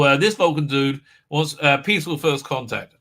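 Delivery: usual speaking voice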